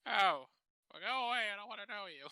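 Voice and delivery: high, loud brush-off; dismissive old resident. Retold or imitated. Cranky resident dismissing the activities worker, high loud brush-off. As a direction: shoo them away up high. Acted rude resident reply.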